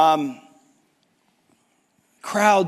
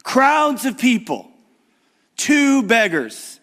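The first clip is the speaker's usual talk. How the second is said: preaching; 7 semitones higher, 5 dB louder than usual. Acted. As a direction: build the scene with rising urgency. Preaching climax.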